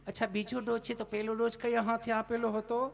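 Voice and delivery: voiced nurse. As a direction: play the sweetly curious nurse.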